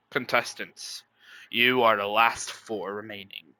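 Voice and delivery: low, lightly voiced game host; a low host announcing the final four.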